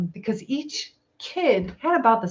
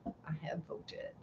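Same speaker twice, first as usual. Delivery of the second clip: whisper; 3 semitones lower, 22 dB quieter than usual. Pretend. Repeated in a whisper.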